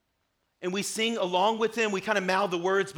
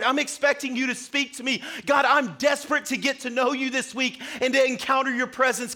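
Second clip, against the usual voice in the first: raised, driving preacher's voice, higher and louder; full-force preaching; 5 semitones higher, 4 dB louder than usual. Acted. Pastor urging the church to come in desperate for God, fervent preaching. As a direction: build the fervour, praying aloud for the church.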